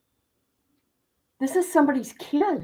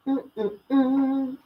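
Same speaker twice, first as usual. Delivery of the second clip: ordinary delivery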